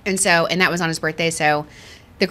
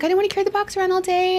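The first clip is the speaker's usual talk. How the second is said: fiancé's excuse; 11 semitones higher, faster than usual. Retold or imitated. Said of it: "And he was like".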